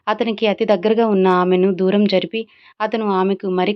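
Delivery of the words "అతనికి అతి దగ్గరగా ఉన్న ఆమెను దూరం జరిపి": ordinary delivery, nothing marked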